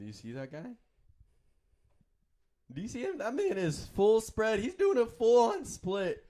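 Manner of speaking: high, strained put-on voice; slightly wrong copied voice. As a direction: squeeze it up to make her laugh. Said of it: "I'm mimicking my voice".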